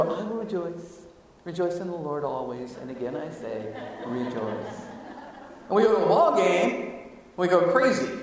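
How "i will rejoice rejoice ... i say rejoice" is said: soft, low, lifeless rejoicing; dull church rejoice. Pretend. Pastor mocking a bored congregation singing rejoice dully. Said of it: "we get to church and we go".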